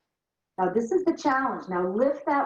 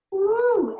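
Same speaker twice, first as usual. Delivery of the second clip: excited woo; 12 semitones higher, 4 dB louder than usual. Real, not acted. Exhale after last rep.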